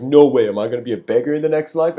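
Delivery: loud, fast panicked outburst; a frightened king shouting his fear of the dream. Retold or imitated. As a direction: blurt it out fast in sudden alarm. King freaks out over dream.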